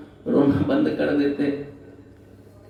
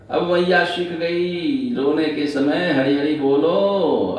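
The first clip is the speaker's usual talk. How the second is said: ordinary talk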